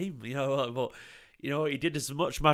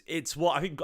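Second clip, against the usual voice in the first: normal talking voice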